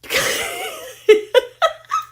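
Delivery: very high squealing laugh; real uncontrolled high giggle; thrilled laughter. Real, not acted. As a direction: squeal with laughter after a shock. Laughing at the discovery.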